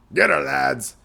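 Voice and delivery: loud, rough order to the crew; rally the pirates to attack; pirate captain shout. Acted. Captain to his crew, loud rallying order.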